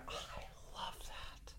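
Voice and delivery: whispered, drawn-in gush; hushed adoration. Real, not acted. Fan gushing over a favorite TV scene, hushed adoration. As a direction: say it almost without voice.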